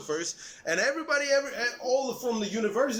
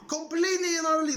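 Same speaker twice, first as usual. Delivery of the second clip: heated outburst; 8 semitones higher, faster than usual. Real, not acted. Ranting about destroyed country.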